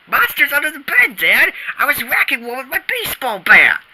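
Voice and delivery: fast, keyed-up explanation; hurried excuse. Acted. Boy blurts an excuse to Dad about monsters, fast and high. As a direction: rush it out in defense.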